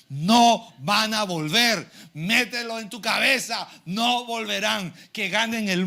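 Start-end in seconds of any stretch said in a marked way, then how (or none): 0.1-1.9 slow, loud, weighted "No van a volver"; "No van a volver" stretched out with full force; emphatic rebuttal. Real, not acted. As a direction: hammer out "No van a volver" word by word. Preacher rebuts the lament forcefully.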